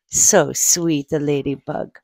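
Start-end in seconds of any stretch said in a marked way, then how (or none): none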